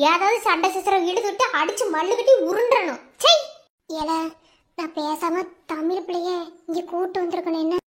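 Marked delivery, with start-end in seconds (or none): none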